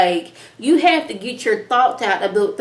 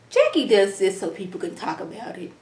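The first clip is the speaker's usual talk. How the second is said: normal talking voice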